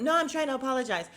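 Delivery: high, loud, rushed protest; mimicking Kyle, fast. Mocking Kyle talking over Sutton, rushed and high.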